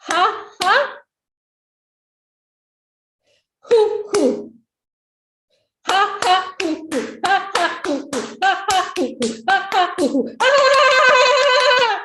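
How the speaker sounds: high ha-ha, falling hoo-hoo, in rhythm; measured laughter drill building to a shout; structured laughing. Shown as a demonstration. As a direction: chant the laugh syllables, ending in a loud high peal. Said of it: "We'll do structured laughing now".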